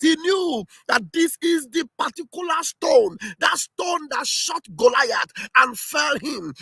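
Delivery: high, exultant rally-speech proclamation; impassioned excitement. Real, not acted. Supporter proclaiming the leader foresaw it all, impassioned. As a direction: proclaim it like a fired-up preacher. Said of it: "why am I sounding this way".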